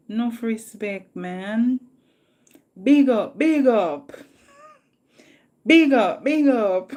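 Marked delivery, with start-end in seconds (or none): 2.8-6.9 big up, shouted louder and higher; hyped shout-out. Real, not acted. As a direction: hype the viewer up with a loud call. Hyped repeated shout-out to viewer.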